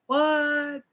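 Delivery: very high squeak of disbelief; squeaky surprised what. Acted. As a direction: squeal it in shock. Mock disbelief at rules.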